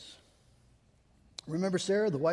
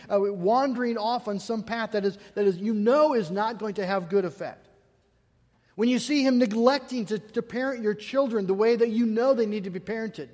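raised, louder pulpit exhortation; impassioned preaching; 5 semitones higher, 6 dB louder than usual. Real, not acted. Preacher urging wives not to give in to fear, forceful preaching. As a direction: press the charge home with rising urgency.

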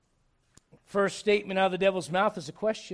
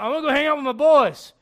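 loud, raised-pitch mimicry; mock immature husband; 5 semitones higher, 9 dB louder than usual. Preacher mimicking husband heading out with friends, loud mock announcement. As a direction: blurt it out like an overeager kid.

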